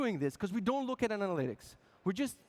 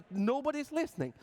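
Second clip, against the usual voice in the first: higher-pitched mock lament; exasperated complaint; 7 semitones higher than usual. Band complaining that users go unheard, exasperated. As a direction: cry it out like you're being ignored.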